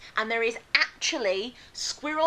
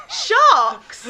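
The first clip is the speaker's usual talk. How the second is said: laughing shout; 14 semitones higher, 9 dB louder than usual. Real, not acted. Laughing outburst mid fact.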